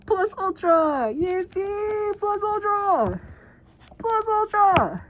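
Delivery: hammed-up triumphant hero shout; hero battle cry. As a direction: yell the catchphrase like an anime hero.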